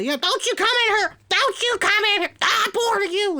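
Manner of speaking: very high, fast and loud, full squeal; shrill mock of the ref telling him off; high-pitched referee voice. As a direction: squeak it out like an indignant little referee. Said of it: "he's saying this to Double A".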